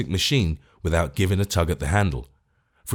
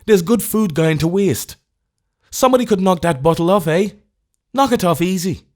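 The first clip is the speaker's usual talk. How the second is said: higher, louder Irish brogue, quick; tempted Irish tramp; 11 semitones higher, 5 dB louder than usual. A narrator's reading. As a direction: coax yourself, Irish tramp eyeing the milk.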